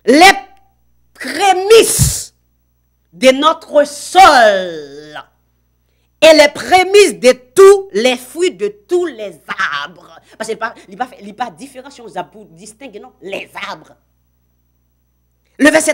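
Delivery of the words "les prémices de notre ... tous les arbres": preaching, drawn out. Acted. Prédicateur martèle le texte.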